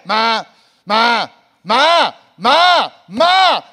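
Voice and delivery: loud, rising, insistent calls; child calling mom over and over. Pretend. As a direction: nag like a kid who won't stop.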